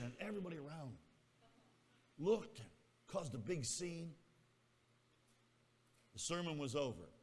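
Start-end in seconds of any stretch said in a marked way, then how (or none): none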